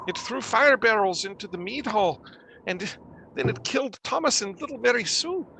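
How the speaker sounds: high, halting, fearful voice; distraught survivor. Acted. Villager telling the party who was killed last night, distraught. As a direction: recount the horror haltingly.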